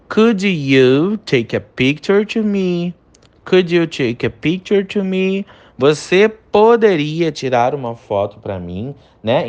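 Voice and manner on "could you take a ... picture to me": slow and high, "Could" stretched; slow English model sentence. Shown as a demonstration. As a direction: read it slowly for students to repeat. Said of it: "Primeira frase". (frase de exemplo lenta e bem articulada)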